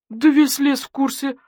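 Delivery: wolf's howled protest. A narrator's reading. Wolf under suspicion protests to the investigators, howling. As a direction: protest in a high-pitched howl. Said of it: "взвыл волк".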